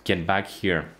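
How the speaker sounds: ordinary delivery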